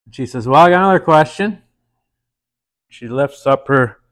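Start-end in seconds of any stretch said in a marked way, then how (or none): 0.5-1.6 quick, higher and louder teasing lady; a light coy housewife voice; flirty woman. Acted. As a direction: push the next question out eagerly.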